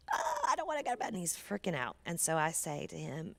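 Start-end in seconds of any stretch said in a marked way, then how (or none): 0.1-1.1 put-on high childish whine refusing bed; whiny child. Son keeps refusing bed.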